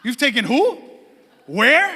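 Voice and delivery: pointed, incredulous questions with pauses between; affronted wife. Retold or imitated. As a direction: challenge each word in mock disbelief. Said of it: "her response was like".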